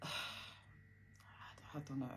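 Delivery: sighed, whispered and very slow; sad sigh. Real, not acted. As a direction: let it out on a tired whispered sigh. Remembering deflated dad.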